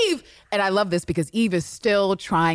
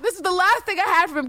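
mock heartbreak; 13 semitones higher than usual. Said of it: "He's like".

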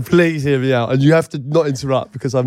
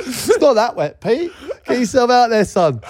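pitched-up teasing voice; high teasing voice; 9 semitones higher than usual. Pretend. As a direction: cheer him on in a mocking high voice. Mimicking wife's encouragement.

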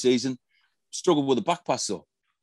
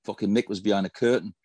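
ordinary talk, nothing marked

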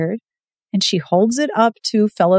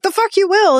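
defiant retort; 11 semitones higher, 3 dB louder than usual. Retold or imitated. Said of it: "And Fellows is like".

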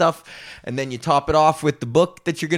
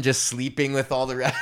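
normal talking voice

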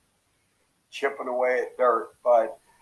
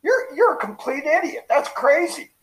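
mock outraged outburst pitched much higher; 13 semitones higher than usual. Coach showing what not to say to someone outrageous, mock high outburst. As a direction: squeak it out like a scandalized know-it-all. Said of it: "you don't say".

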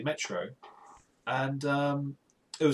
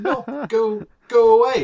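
loud, higher mock protest; acted alarmed protest; 7 semitones higher, 11 dB louder than usual. Tourist to a seller grabbing an arm, loud acted protest. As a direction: fend off a pushy seller, raising your voice. Acts out fending off pushy sellers.

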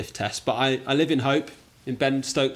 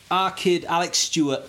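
higher-pitched put-on Manc accent; Manchester accent; 5 semitones higher, 3 dB louder than usual. Shown as a demonstration. Quiz guest naming a cricketer, in a Manc accent. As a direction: play at being Mancunian.

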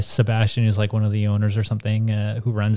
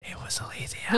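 playful whispered tattle, high and soft; 9 semitones higher, 13 dB quieter than usual. Real, not acted. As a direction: whisper the name like telling on someone. Jokingly blaming a friend.

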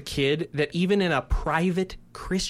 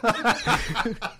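laughing; 7 semitones higher than usual. Real, not acted. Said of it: "faked a British accent".

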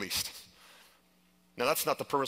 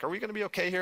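lightly voiced wife, pitched higher; imitating worried wife; 9 semitones higher, faster than usual. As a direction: raise the pitch for an anxious wife's question. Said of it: "my wife was like".

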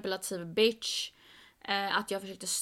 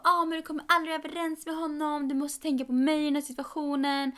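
higher-pitched imitation of an angry complaint; mock angry friend; 6 semitones higher, 3 dB louder, faster than usual. Friend scolding her for refusing his friend, mock angry. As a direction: mimic a friend telling you off. Då blev hon arg på mig.

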